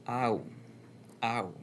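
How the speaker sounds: "ow" gliding from a to u; model diphthong. Shown as a demonstration. Teacher modelling the diphthong "ow" for learners. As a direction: draw "ow" out as a smooth glide. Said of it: "you get the diphthong".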